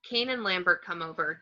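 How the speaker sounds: usual speaking voice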